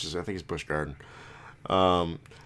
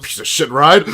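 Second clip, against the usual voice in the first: much louder, higher mock-furious yell; angry shout; 10 semitones higher, 16 dB louder than usual. Friend acting out the angry scream, loud mock fury. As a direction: bellow it like an enraged rider.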